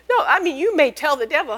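ordinary talk

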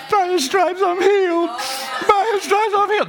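pious sing-song; without the spirit of faith. Shown as a demonstration. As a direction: recite it in a lofty voice, without belief. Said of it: "That's not the spirit of faith".

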